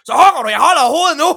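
fast, high, loud hammed-up yell of a bossy grandma; grandmother yelling. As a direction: rattle it off like a frantic old woman barking orders. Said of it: "sagde hun så".

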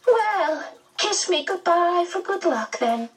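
very high, fluting medium voice; high-pitched, affectionate medium; cheered medium. A narrator's reading. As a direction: coax them in a high character voice.

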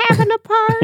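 high-pitched oddball question; weird voice. Retold or imitated. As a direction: flip up into a silly high voice. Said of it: "I just think of that weird".

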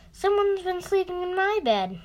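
a lightly acted bear roar; father bear roaring. A narrator's reading. Father bear finds his bed slept in, mild mock roar. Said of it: "roared Father Bear".

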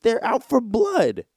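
high, hammed-up complaint about getting shot; mock outrage. To his brother, a high mock-outraged complaint. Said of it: "And I was like".

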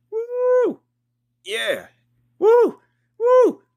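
high falsetto woo, shouted out; whooping cheer. Acted. Pumped fan hyping the Bears in the parking lot, whooping. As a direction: whoop like a crazed tailgater. Tailgate hype before the Bears game.

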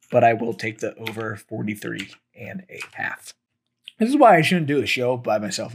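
strained, worn-out voice from talking too long; a voice that is giving out; losing voice. Real, not acted. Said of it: "I'm just losing my voice here".

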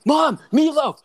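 high, loud yell for mom; mock shout. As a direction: holler it down the hall. Quoting a yelled movie line.

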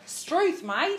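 Alf Stewart. Retold or imitated. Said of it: "You know, like Alf Stewart".

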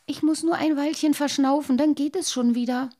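put-on high, weary little water-boy voice; a small child too tired to go on. A narrator's reading. Worn-out boy pleads with his father, high and tired. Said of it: "sagte der kleine Wassermann müde".

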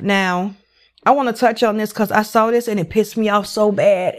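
ordinary talk, nothing marked

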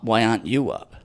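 usual speaking voice

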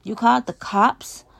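loud, high accusing question; snarled. A narrator's reading. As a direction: throw it as an angry accusation. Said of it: "I snarl".

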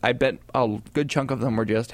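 normal talking voice

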